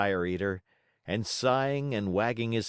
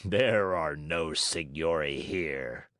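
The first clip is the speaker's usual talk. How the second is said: slow, lightly voiced gruff refusal; a stubborn showman's no; Fire Eater; 4 semitones lower, slower than usual. A narrator's reading.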